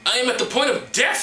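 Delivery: high, swooping mock despair; melodramatic dying man. Starving Isaiah complaining to Jacob, hammed-up dying wail. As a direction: wail it like a ham actor.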